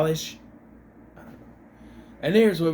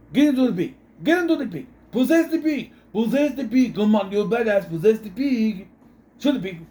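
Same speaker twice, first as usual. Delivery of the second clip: high, raised-voice urging; urgent goading; 7 semitones higher, 4 dB louder than usual. Retold or imitated. Imitating the priest daring the demon into the pig, high urgent commands. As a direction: goad the demon in mock-heroic urgency.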